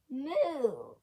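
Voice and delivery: cow moo shouted high; loud moo. Acted. Cow interrupting the joke with a loud moo. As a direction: belt out a big cow moo. Interrupting cow's knock-knock punchline.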